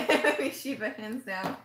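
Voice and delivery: giggly; laughing repeat. Real, not acted. Naming a dream character to viewers, laughing. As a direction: laugh through "Shiva".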